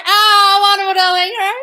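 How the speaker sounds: very high, loud squeal; gushing delight. Real, not acted. Host thrilled by a viewer's thanks, gushing. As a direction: cry it out, thrilled and theatrical.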